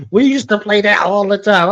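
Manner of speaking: blurting out with delight; excited recall. Retold or imitated. Host retelling her own reaction, excited and loud. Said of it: "I was like".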